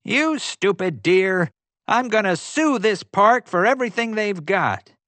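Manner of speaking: high, loud furious yell at the elk. A narrator's reading. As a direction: shake a fist and rage. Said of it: "shook his fist at the bull elk".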